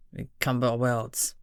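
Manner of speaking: low, slow and soft, grimly. A narrator's reading. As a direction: drop it into a grim murmur. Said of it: "he declared grimly".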